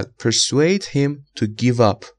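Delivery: very slow, persuade stressed, each word apart; slow model reading. Shown as a demonstration. Teacher reads the first persuade example slowly. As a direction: read the example at a crawl. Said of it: "مثال اول".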